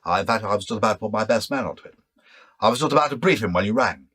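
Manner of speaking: loud, fast, commanding military officer; Brigadier impression. Shown as a demonstration. Impressionist shows how he voices the Brigadier, loud and brisk. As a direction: bark the orders like a brigadier on the phone. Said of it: "you can see the characters there".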